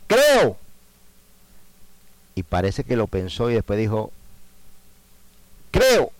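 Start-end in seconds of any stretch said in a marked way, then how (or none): 0.1-0.6 sudden high loud cry; cried out. Acted. The boy's father crying out to Jesus, a loud high cry. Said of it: "clamó y dijo". 5.7-6.1 sudden high loud cry; cried out. Acted. The boy's father crying out to Jesus, a loud high cry.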